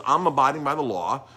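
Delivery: retold insistence, high and loud; indignant protest. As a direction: insist on innocence as the wronged owner. Retold owner protesting his innocence.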